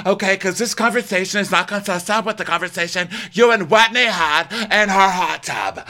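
high, loud, shrill-free rant; hammed-up yelling at the table; mock yelling Lisa. Co-host imitating Lisa yelling at Jen, hammed-up. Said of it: "Lisa's like".

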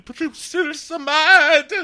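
high, drawn-out hammed-up wail; slow, loud, squeaky fake cry; mock sobbing. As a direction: blubber it in exaggerated upset. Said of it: "sob face up there".

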